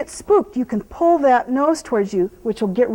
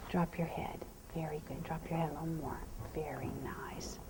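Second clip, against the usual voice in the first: soft whisper; 7 semitones lower, 20 dB quieter than usual. Real, not acted. Trainer whispering softly so the horse lowers his head. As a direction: breathe the words to settle him.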